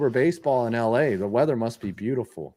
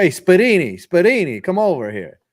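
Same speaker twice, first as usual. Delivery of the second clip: loud, higher New York Italian call; loud Italian-American New York accent; 6 semitones higher, 6 dB louder than usual. Pretend. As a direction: holler across the street like a Queens guy. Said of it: "a Nicky Cass".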